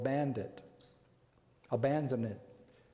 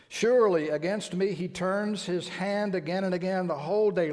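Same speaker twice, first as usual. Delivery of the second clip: higher and louder, crying out Jeremiah's complaint; impassioned scripture lament; 3 semitones higher, 5 dB louder than usual. Acted. Preacher voicing Jeremiah's lament to his congregation, impassioned and loud. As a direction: preach the lament at full force, voice lifted.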